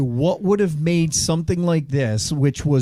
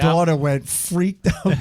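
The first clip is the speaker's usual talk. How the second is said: normal talking voice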